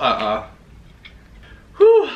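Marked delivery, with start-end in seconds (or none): 1.8-2.1 loud, very high "whew"; short loud high exhalation; high whoop. Real, not acted. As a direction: let out a sudden high whew. Reacting to the food talk.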